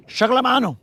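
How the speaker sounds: high put-on call of chocolate mano, stress on chocolate; playful catchphrase. Angler hypes his bait to viewers, high playful call. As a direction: sing out chocolate mano like a hype line.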